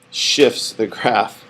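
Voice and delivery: drawn-out, very slow "shifts the graph", each word separate; slow it right down so "shifts" is clear; said slowly. Shown as a demonstration. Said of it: "I have to say it slow".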